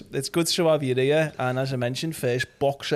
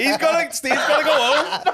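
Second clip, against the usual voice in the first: higher, laughing plea; 11 semitones higher than usual. Real, not acted. Host urging the guest to stop, laughing, higher. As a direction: laugh while hurrying things along.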